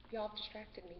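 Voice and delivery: usual speaking voice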